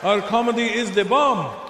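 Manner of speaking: high, slow mock Iraqi accent; Iraqi club host accent. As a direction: proudly announce it in a hammed-up Iraqi accent. Imagined Iraqi comedy club slogan.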